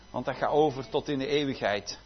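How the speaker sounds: usual speaking voice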